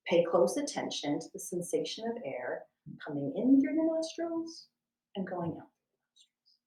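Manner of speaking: ordinary talk, nothing marked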